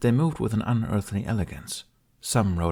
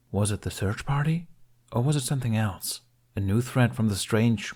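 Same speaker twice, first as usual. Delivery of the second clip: ordinary delivery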